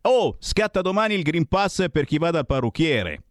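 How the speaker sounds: ordinary delivery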